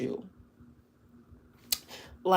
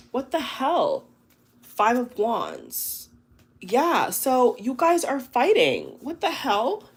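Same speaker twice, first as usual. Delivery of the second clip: much higher, louder, disbelieving exclamations; incredulous what the hell; 6 semitones higher, 3 dB louder than usual. Real, not acted. Reader to viewers of pile three, exclaiming in disbelief. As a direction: react like the cards just shocked you. Tarot reader reacting to fighting card.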